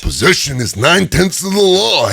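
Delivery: high, raspy Macho Man growl; Macho Man impression. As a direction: deliver the law like a wrestling promo. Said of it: "Macho Man with Scalia being like".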